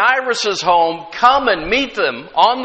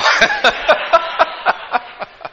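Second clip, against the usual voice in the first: long, high laugh; 8 semitones higher than usual. Real, not acted. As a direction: crack up at your own impression. Laughing at own mother impression.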